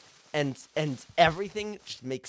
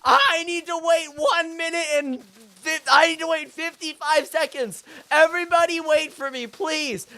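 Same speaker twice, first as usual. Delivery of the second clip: screaming; 12 semitones higher, 6 dB louder than usual. Acted. Customer stalling for time in a Walmart, screaming. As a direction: scream it to the whole store, hammed-up. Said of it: "you start screaming".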